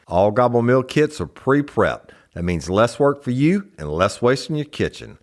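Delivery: host reads a sponsor spot for the audience, bright ad voice. Acted. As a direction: sell the meal kit to the listeners. Sponsor ad read.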